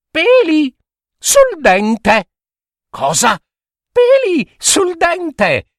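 very high mocking child voice with giggles; high sing-song teasing rhyme; teasing little girl. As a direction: taunt the monster cheekily. Lucilla taunting the monster.

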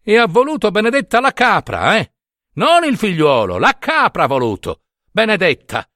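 molto più acuto, si discolpa; lamento giustificativo. Acted. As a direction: ripete agitato la sua scusa. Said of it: "badava a dire". (il prete si giustifica con la folla in un lamento acuto)

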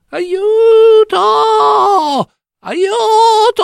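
high, loud, thin cry for help like a gull; desperate cry for help. Acted. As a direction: shriek for help, chained to a rock. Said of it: "udì soltanto un pigolio".

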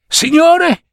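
high gasped cry, out of breath; blurting it out to the boss in a panic; breathless panic. A narrator's reading. Printer's boy gasping to his boss, high and breathless. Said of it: "disse annaspando per via del fiato corto".

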